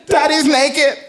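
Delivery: put-on high, wailing child's voice; a small kid near tears over what she saw; upset little girl. Daughter telling mom about dad, high and distressed.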